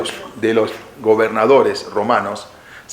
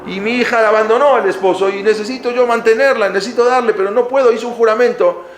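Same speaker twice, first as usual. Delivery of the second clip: much higher, pressing retelling of a plea; acted plea; 10 semitones higher than usual. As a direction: plead your case, voice raised high. Father-in-law pleading his case. (súplica imitada en tono agudo)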